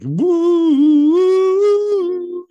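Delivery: very high, loud, long held woo; mock skinwalker wail. Co-host playing skinwalker hypnotists, high eerie wooing. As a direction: wail one spooky sustained high note. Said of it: "And they're like".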